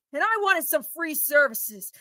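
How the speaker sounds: normal talking voice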